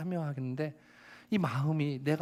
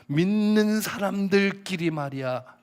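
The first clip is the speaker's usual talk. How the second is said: higher, louder, slow mocking shout; imitated yelling; 8 semitones higher, 8 dB louder, slower than usual. Woman yelling at the pastor about believers, imitated shout. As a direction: throw her scornful yell back. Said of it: "소리를 지르면서".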